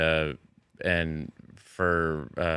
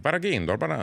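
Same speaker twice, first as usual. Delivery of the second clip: higher mock Italian babble; Vinny Vedecci gibberish; Italian accent; 8 semitones higher, much faster than usual. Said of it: "this Italian guy".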